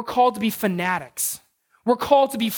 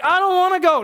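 put-on higher and louder, a sulky boy's whine; whiny kid voice; 7 semitones higher, 4 dB louder than usual. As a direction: whine like a kid refusing karate. Son protesting, voiced by father.

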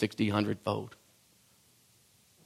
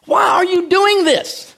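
exasperated protest; 6 dB louder than usual. Retold or imitated. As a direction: plead in disbelief, slowing on the last line. Disciples protesting to Jesus.

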